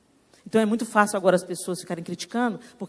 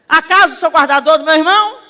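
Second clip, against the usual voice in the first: higher, louder insolent comeback; rude retort; 6 semitones higher, 9 dB louder than usual. Acted. Cain answering God back, higher and louder, insolent. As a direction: throw it back at God with cheek. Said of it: "resposta mal criada pra Deus".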